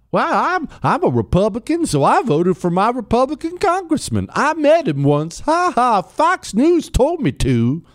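mock clueless voter. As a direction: play the proud uninformed Republican, a little dopey.

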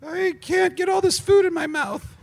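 put-on high giggly little kid; child voice. Shown as a demonstration. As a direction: whine it like a small child with a full mouth. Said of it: "And now a child?".

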